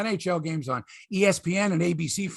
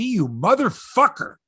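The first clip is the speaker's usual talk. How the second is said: mock angry; 5 semitones higher, 4 dB louder, slower than usual. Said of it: "Like, he's pissed".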